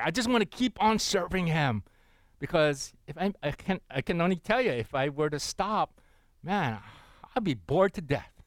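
usual speaking voice

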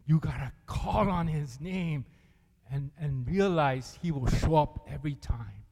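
usual speaking voice

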